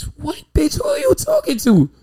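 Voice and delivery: sharp, high indignant comeback; outraged high retort. Retold or imitated. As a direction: snap back in disbelief. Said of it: "they say".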